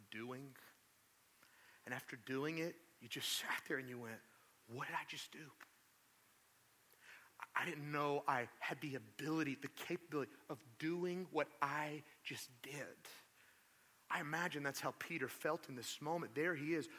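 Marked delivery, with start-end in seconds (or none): 4.7-13.1 whispered self-reproach, softer; hushed dismay. Retold or imitated. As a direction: breathe it out in shock. Voicing shocked self-reproach.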